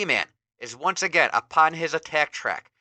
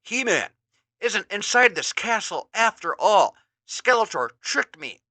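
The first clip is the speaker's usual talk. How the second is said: higher dawning realization; Ram Man realizing; 8 semitones higher, 4 dB louder than usual. Acted. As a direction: realize the trick. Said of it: "he thinks to himself".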